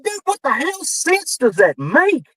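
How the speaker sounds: very high, slow exasperated question; retold incredulous outburst. Writer answering John's excuse, retold incredulous exasperation.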